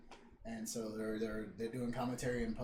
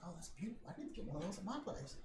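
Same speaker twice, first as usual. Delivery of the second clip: higher, softer admiring voice; 5 semitones higher, 8 dB quieter, faster than usual. Retold or imitated. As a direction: admire the house quietly. Peter admires the house.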